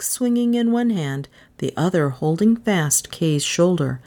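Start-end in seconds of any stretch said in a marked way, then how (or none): none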